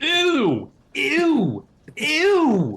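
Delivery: high, loud grossed-out ew; disgusted ew. Real, not acted. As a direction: recoil in disgust. Disgusted by talking mouse.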